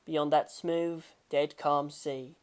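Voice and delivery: usual speaking voice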